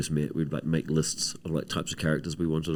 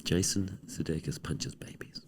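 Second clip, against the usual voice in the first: slow hushed whisper, sharing a secret; mock-secret whispered gossip; conspiratorial whisper; 2 semitones lower, 10 dB quieter, slower than usual. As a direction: lean in and whisper the accusation. Joking aside about the punch.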